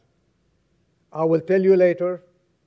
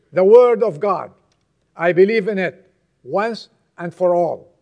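higher, forceful pulpit delivery; impassioned preaching; 4 semitones higher, 7 dB louder than usual. Acted. Preacher warning the church to follow the Word of God, impassioned. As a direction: press each phrase on the congregation with conviction.

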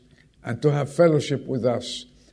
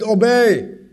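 put-on high shrill kid's scream of the word; child's shriek; 9 semitones higher, 7 dB louder than usual. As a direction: shriek it like an upset little boy. Child repeats the bad word.

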